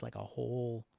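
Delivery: normal talking voice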